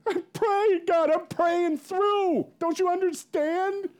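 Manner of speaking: high, loud, drawn-out wail; mock desperate plea. Pastor answering God's question, mock desperate wailing. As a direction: cry it out in comic exasperation.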